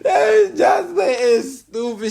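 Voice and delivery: high, loud, drawn-out laughing squeal; cracking up. Real, not acted. Reacting to Jocelyn's insults in the clip, squealing with laughter.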